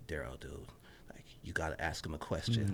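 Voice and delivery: hushed whisper to himself; nervous self-talk whispered under his breath; inner voice. Retold or imitated. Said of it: "saying to myself".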